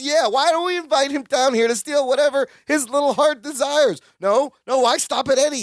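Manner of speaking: sarcastic rant about thieves, pitched high; freaking out. Retold or imitated. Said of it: "Larry is just freaking out".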